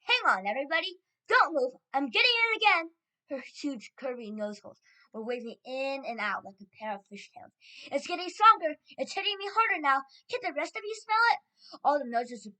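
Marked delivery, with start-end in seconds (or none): 0.0-2.9 loud, urgent cry climbing higher; Mildred. A narrator's reading. Witch catching the scent, crying out. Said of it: "cried the witch called Mildred". 7.9-11.4 high, loud, urgent calling; excited witch. A narrator's reading. Witch asking the rest to sniff, calling excitedly.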